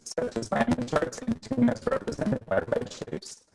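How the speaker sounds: ordinary talk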